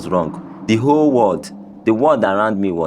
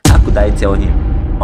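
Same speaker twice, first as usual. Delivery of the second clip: plain reading voice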